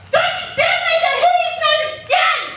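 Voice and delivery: high, very loud shouted threat; furious yelling. Acted. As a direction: yell like an enraged father at his child. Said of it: "He would just yell".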